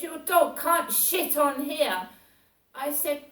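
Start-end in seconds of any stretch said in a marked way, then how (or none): 0.0-2.1 louder, higher retold order; mock yell. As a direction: bark the rule at her. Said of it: "He said".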